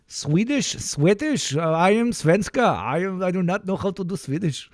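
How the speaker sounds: high, lilting attempt at Swedish; badly faked singsong Swedish accent. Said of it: "I demand Swedish".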